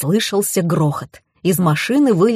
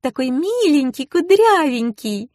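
put-on very high girlish excitement; high-pitched cooing enthusiasm; gushing delight; 9 semitones higher than usual. A narrator's reading. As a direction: fuss over cute doggies in a high voice. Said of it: "зааплодировала девушка".

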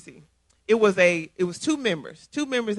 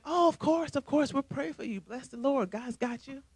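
higher, softer, hollow politeness; dismissive sweetness; 5 semitones higher, 7 dB quieter than usual. Retold or imitated. Two clique friends brushing off the newcomer, sugary and hollow.

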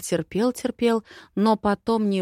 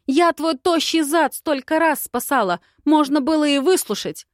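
higher and louder, indignant; angry yelling; 8 semitones higher, 5 dB louder than usual. A narrator's reading. Heroine yells at the adviser she saved, angry. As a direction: hurl it at him.

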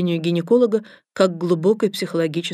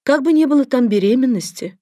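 shy clinical tone; 6 semitones higher, 4 dB louder than usual. Acted. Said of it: "стыдливо добавила".

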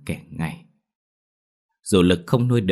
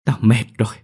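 high, raised, upset voice; tearful outburst; 6 semitones higher, 4 dB louder than usual. A narrator's reading. Weary grandmother refusing to care for the child, upset and high. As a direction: give up in bitter exasperation. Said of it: "Nước mắt đã chảy thành hai hàng".

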